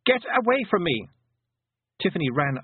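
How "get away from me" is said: put-on high-pitched panicky boy shout; boy's shout. A narrator's reading. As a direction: shout them away as you flee. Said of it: "He turned and shouted".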